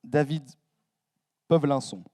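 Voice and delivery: name said with a light English accent. Shown as a demonstration. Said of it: "mon accent anglais".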